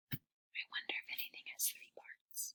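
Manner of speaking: soft wondering whisper. Acted. Teacher whispering a wonder to the class. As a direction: muse it quietly like a secret.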